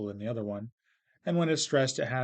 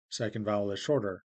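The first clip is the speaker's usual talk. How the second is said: normal talking voice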